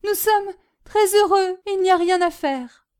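high, loud, mildly weepy protest; tearful reassurance. A narrator's reading. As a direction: insist on happiness through tears. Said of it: "en sanglotant toujours".

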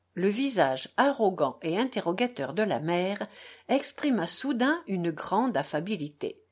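ordinary delivery, nothing marked

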